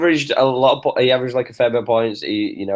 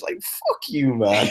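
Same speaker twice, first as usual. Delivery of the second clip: laughing; 3 semitones higher, 4 dB quieter, much slower than usual. Real, not acted. Mocking Americans, laughing through the curse.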